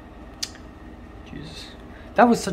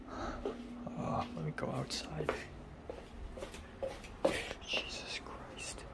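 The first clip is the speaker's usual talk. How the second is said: whispering; 18 dB quieter, slower than usual. Real, not acted. Scared of cockroach, whispering.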